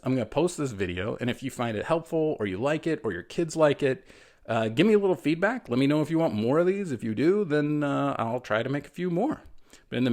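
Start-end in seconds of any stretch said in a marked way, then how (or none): none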